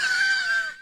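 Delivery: very high squealing laugh; uncontrolled laugh. Real, not acted. Reviewer losing it while acting the chief, squealing laughter. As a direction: burst out laughing mid-impression. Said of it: "can't even keep a straight face".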